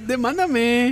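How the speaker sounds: más agudo y lento, en broma; imitación burlona. Pretend. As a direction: imita con sorna. (demándame en voz de burla)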